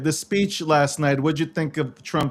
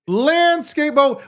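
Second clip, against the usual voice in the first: emphatic repeat; 8 semitones higher, slower than usual. Real, not acted.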